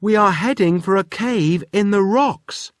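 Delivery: high, loud warning; urgent call. Acted. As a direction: warn the professor of the cave. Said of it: "called Wilf".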